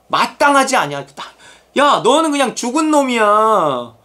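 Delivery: high, emphatic acted reprimand; scolding. God to protesting believer, scolding.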